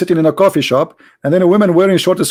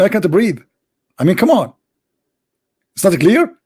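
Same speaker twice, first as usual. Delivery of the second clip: ordinary talk, nothing marked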